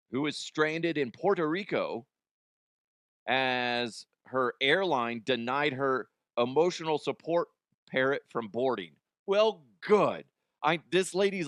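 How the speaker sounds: ordinary talk, nothing marked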